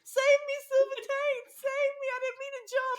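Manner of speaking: impaled victim begging Silver Taint; mock pleading. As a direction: beg for rescue in mock panic. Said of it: "And it's just like".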